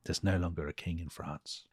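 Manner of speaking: low, soft, resigned quote; tired and sad. Acted. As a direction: voice a weary king quietly giving up. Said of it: "He's just tired and sad".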